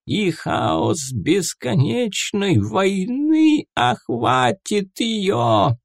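high, slow entranced prophecy; prophetic trance voice. Acted. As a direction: chant it like a seer in trance. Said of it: "произнесла Пифия".

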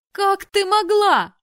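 very high, loud, slow wail; furious sister bursting in; outraged cry. Acted. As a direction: burst in screaming betrayal. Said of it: "влетела в спальню Белла".

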